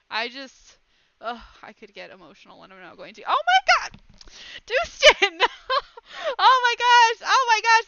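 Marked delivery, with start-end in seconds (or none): 3.3-7.9 loud, high screams of disbelief; giddy high-pitched squealing; shocked delight. Real, not acted. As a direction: shriek as surprise gifts pour in. More gifts suddenly arrive.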